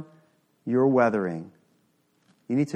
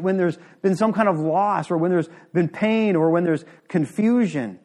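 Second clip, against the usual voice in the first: normal talking voice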